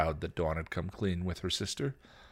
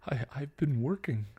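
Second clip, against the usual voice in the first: high, halting, tearful voice; faltering on the edge of crying; choked up; 8 semitones higher than usual. A narrator's reading. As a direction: choke it out between pauses. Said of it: "Buffy choked".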